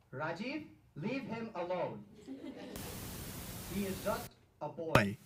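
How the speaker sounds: calm the father down gently; grandma voice. Retold or imitated. Grandma defends grandson in argument.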